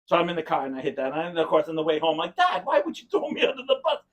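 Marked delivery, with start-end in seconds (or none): 2.3-4.0 retold protest. Teenage self protests to dad.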